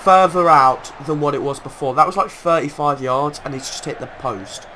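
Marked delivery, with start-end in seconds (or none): 0.0-3.4 loud, excited play-by-play; excited commentary. Real, not acted. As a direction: call it like a commentator. Excited commentary on near goal.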